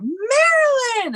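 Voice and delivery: high, drawn-out holler of a name; excited shout. Retold or imitated. Child spotting Marilyn through an opened door, excited shout. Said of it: "then I just shout".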